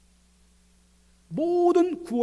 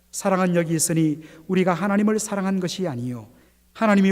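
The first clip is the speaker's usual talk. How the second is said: loud group scripture reading; 7 dB louder than usual. Acted. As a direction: read it out together, loud. Congregation reads scripture aloud.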